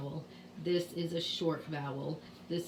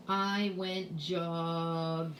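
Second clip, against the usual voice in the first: slow, with "jogging" stretched into jog and ing; sounding out; much slower than usual. Shown as a demonstration. Teacher voicing a child writing a sentence, slowly sounded out. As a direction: draw out "jogging" in two held parts.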